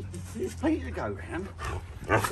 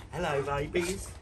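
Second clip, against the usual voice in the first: normal talking voice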